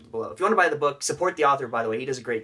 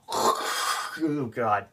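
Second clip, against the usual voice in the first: whispered gasp; 2 semitones lower than usual. Pretend. Brain's reaction voiced.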